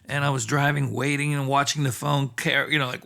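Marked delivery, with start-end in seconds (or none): none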